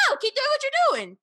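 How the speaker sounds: high, loud insistent retort; yelled comeback. Retold or imitated. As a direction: shout back over the other person, re-enacted. Retelling shouted comeback to stranger.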